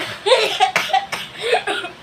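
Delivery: sudden high giggle; laughing. Real, not acted. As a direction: burst out laughing. Giggle after dropping something.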